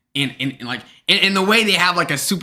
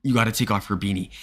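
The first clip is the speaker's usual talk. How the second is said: quietly voiced teacher, low and soft; discreet teacher voice; 7 semitones lower, 7 dB quieter than usual. Teacher taking a student aside about a hoodie, discreet and quiet. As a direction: keep it private, low-key and softer. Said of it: "never wanna tell you privately".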